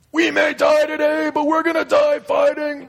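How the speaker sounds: high heroic battle shout; Braveheart battle cry. Pretend. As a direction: roar it like a warrior rallying troops. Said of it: "Mel Gibson from Braveheart".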